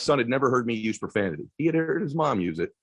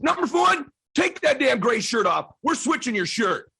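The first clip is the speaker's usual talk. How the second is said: commanding dad; 11 semitones higher than usual. Retold or imitated. Dad ordering son.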